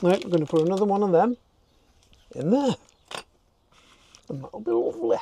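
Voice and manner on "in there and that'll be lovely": put-on Yorkshire accent. Vlogger pleased with his fix, in a put-on Yorkshire accent. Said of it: "Don't know why I've gone all Yorkshire".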